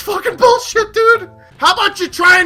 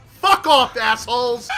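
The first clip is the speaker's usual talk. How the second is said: usual speaking voice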